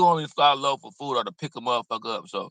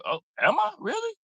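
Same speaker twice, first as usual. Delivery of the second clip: mock surprise. Mock incredulous reaction.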